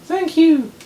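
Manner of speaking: very high, loud falsetto character voice; piercingly high cartoonish put-on voice; [name]. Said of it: "[name]".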